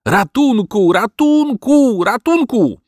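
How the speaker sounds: high, loud cries for help; frantic shouting. Acted. Dog wakes his sleeping master, frantic shouting. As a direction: yell for help, stretching the vowels.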